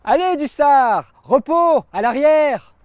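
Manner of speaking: loud, high brigadier's command; shouted order. Acted. Brigadier sending soldier Dussard to the rear, shouting. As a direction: call the order out across the trench. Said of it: "m'a crié un brigadier".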